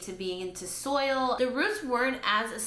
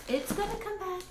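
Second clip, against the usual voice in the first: much higher sing-song reassurance; coaxing; 9 semitones higher than usual. Real, not acted. Vlogger reassuring her pathetic plant, higher coaxing tone. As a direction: coax the plant like a pet.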